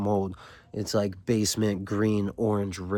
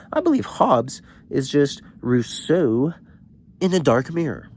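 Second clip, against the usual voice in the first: popping off; 5 semitones higher than usual. Retold or imitated. Well-read student lecturing the adults, mock intense. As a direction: imitate a show-off reader making big claims. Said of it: "he's just popping off on them".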